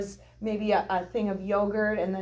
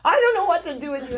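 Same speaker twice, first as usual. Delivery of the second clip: higher, louder and fast, exasperated; exasperated husband; 8 semitones higher, 8 dB louder, much faster than usual. Retold or imitated. Husband to hungry bride, a fast exasperated outburst. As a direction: play the husband at his wits' end, fast. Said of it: "And so he's like".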